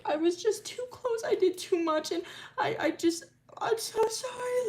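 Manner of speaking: high, thin tearful apology; acted crying, pitch far up and plaintive; sobbing. Milo apologising to Arden for his part, acted sobbing. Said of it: "just break out in sobs".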